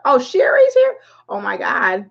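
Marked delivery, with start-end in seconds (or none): 0.0-1.0 delighted surprise. Real, not acted. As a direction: squeal of surprise, way up high. Friend shows up in the chat.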